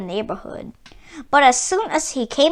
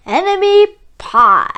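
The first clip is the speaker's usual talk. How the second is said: satisfied dad; 4 semitones higher, 4 dB louder than usual. A narrator's reading. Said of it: "he said, satisfied".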